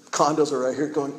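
ordinary delivery

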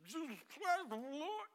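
high, slurred drunken attempt; a hint of a dazed drunk; mildly slurred imitation. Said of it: "is like a drunk person".

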